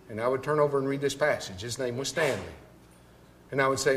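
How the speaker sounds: usual speaking voice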